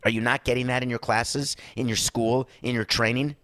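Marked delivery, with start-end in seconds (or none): none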